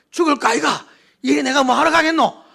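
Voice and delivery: loud, high Gyeongsang dialect complaint; Gyeongsang dialect grumble. Retold or imitated. Preacher voicing a blind man who turns back, in loud Gyeongsang dialect. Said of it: "제가 만약 그 시각장애인이었다면".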